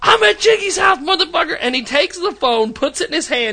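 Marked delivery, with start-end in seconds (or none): 0.0-1.6 much higher, cocky imitation; mock high taunt. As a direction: mimic him taunting, voice pitched up. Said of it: "Mike goes".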